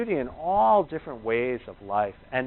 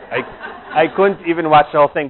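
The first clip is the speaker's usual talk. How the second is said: laughing quote; 3 semitones higher, 8 dB louder than usual. Retold or imitated. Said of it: "He goes".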